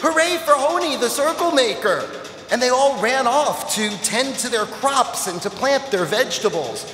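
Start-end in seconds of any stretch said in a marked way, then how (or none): 0.0-2.0 high, bright cheer for the hero; crowd cheering. Acted. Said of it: "all the people cheered".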